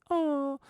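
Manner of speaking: weary high oh; tired sigh. Retold or imitated. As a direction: let out a worn-out oh. Imitating the tired grunt contest.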